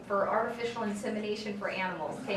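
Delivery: ordinary talk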